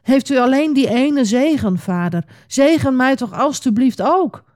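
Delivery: high-pitched, mildly tearful begging; understated storybook wail; tearful pleading. A narrator's reading. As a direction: plead as if close to crying. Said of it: "Huilend antwoordde Esau".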